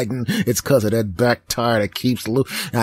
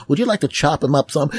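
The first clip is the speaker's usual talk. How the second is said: quick, higher offering voice; lightly voiced worker; 5 semitones higher, faster than usual. Worker offering Thomas the chopper, quick and higher. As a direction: offer it briskly.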